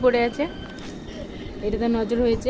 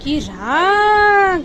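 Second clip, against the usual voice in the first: mock pet voice; 7 semitones higher, 7 dB louder, much slower than usual. Mimicking the angry pet.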